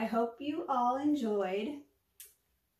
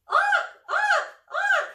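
loud, much higher squawks; gleeful raven squawks; 10 dB louder than usual. Acted. Raven shouting with glee about his new home, high loud squawks. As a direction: squawk with joy like a happy raven. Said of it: "Mac shouted with glee".

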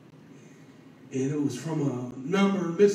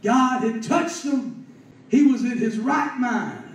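impassioned preaching; 7 semitones higher, 6 dB louder than usual. Real, not acted. Preacher testifies that God had touched him, impassioned. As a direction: proclaim it to the whole congregation.

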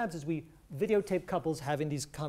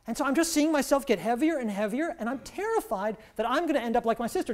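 acted-out distress, louder and higher as it builds; overwhelmed wife; 4 semitones higher, 5 dB louder than usual. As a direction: quote a wife venting, increasingly worked up.

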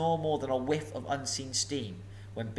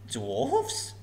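much higher, feigned astonished squeak; pretended surprise; 12 semitones higher than usual. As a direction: fake innocence. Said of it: "in pretended surprise".

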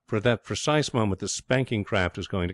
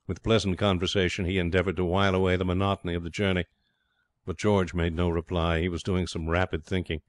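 plain reading voice

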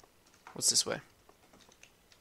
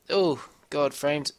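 ordinary talk, nothing marked

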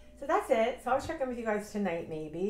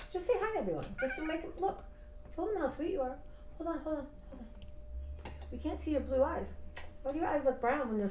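higher, softer coaxing voice; pet talk; 6 semitones higher, 4 dB quieter than usual. Real, not acted. Owner coaxing her dog to say hi, in soft pet talk. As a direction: coax the dog gently to the camera.

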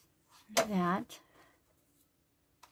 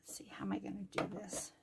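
quick whispered musing; 12 dB quieter, faster than usual. Real, not acted. Crafter thinking aloud over her page in a fast whisper.

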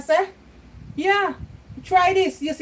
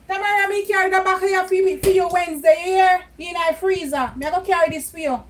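calling out; 6 semitones higher, 5 dB louder than usual. Real, not acted. Calling across house to Tamara.

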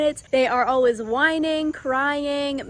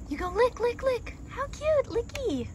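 higher cooing pet voice; 10 semitones higher than usual. Real, not acted. Owner cooing at the puppy drinking, high baby talk. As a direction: coo at the puppy lapping water.

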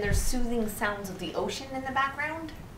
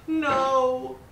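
higher, drawn-out no; dismayed cry; 6 semitones higher, 4 dB louder than usual. Real, not acted. Player slipping off the rock, dismayed cry. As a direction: cry out as it slips away.

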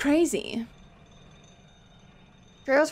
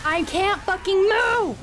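frustrated yell; 7 semitones higher than usual. Real, not acted. Streamer stuck in the game yelling in frustration.

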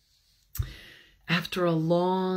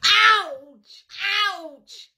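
much higher, louder yelp of pain; yelled ouch; 9 semitones higher, 4 dB louder than usual. Acted. Stung bear yelps to the bees, high and loud. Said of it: "he yelled".

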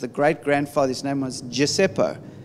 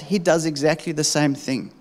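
ordinary talk, nothing marked

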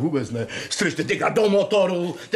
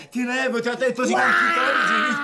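rychlé hlasité vysvětlování; 7 semitones higher, faster than usual. Acted. Excuse shouted into phone, fast.

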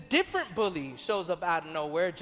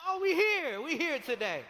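playful burst of excitement, starting high; mock-excited exclamation; 6 semitones higher than usual. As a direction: re-enact getting excited, pitch jumping up then settling. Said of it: "I got excited".

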